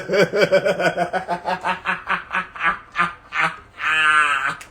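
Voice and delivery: helpless laughter slowing into spaced-out bursts; long cackling laugh. Real, not acted. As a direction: crack up at your own impression. Cracks up at own impression.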